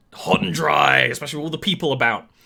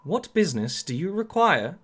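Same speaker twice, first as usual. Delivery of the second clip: higher-pitched, sing-song droid voice; protocol droid; 5 semitones higher than usual. Acted. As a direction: inquire like a formal service droid. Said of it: "the protocol droid".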